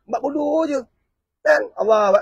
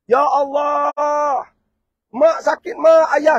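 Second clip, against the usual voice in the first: a louder, higher cry of pain; anguished cry; 4 semitones higher, 7 dB louder, much slower than usual. Acted. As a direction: wail for mother and father from the flames. Child crying out in hellfire.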